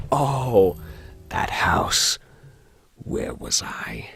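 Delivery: low, slow, hushed narrator; game story narration. Acted. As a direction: murmur it slowly to yourself.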